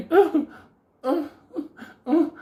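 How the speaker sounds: loud, high grunts, spaced apart; mock pained grunts with pauses between; imitated grunts. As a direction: mimic yelps at each dart hit. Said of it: "I'm like".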